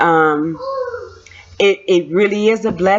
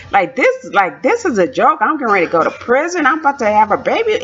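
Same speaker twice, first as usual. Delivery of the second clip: ordinary talk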